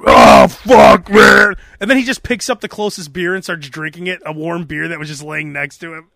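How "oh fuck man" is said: loud hungover groan; groggy Phil impression. Imitating Phil waking up hungover, loud groan. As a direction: groan it out like a wrecked rocker waking up. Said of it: "him waking up".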